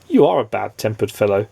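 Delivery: ordinary delivery, nothing marked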